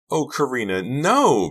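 high, loud dismayed cry; horrified cry. A narrator's reading. Pretty hears what Karina did, horrified cry. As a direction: cry out in horror, rising loud. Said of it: "Pretty cried".

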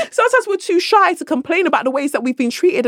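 words pushed out through high laughter; laughing while talking. Real, not acted. Laughing at own story.

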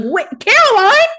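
hammed-up Klaus crying out in alarm; panicked shout. Klaus sees Caroline grab the knife, hammed-up panicked yell.

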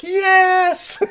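high, loud cry of triumph; hammed-up victory cry; mock triumphant yell. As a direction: shout it high in mock delight. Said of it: "It's like".

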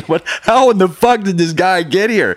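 loud, high, laughing disbelief; mock the shocked staff at the window; incredulous shout with a laugh; astonished scientists. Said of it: "it's as if".

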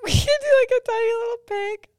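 high, squeaky, breaking into laughter; barely getting the idea out through giggles; laugh-crying. Real, not acted. Host pitching a shirt design while crying with laughter.

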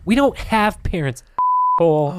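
high, loud bratty retort; mock-offended kid talking back; kid snapping back. Rude orphan kid snapping back at Rob. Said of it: "the little kid that said goes".

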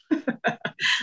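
short breathy laugh; quick airy real chuckle. Real, not acted. Narrator amused by the shoe remark, real chuckle.

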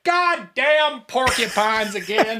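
lightly voiced grumpy old man; grumbling old man. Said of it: "and he's like".

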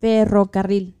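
Ferrocarril with a loud rolled rr; Ferrocarril, crisp trilled rr. Shown as a demonstration. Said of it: "do you have trouble rolling your R's".

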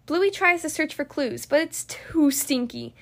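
ordinary delivery, nothing marked

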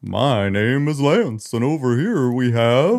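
slow, hammed-up Santa host; a hint of jolly Santa. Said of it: "What does Santa sound like?".

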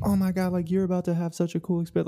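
put-on high, fast, gushing kid voice; excited kids voice. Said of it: "They're like".

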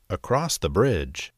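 ordinary delivery

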